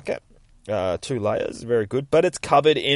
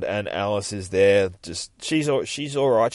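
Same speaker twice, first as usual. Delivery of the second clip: normal talking voice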